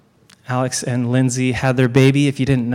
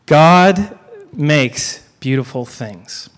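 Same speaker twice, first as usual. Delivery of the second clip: slow deliberate; much slower than usual. Shown as a demonstration. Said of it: "This is key to God's character".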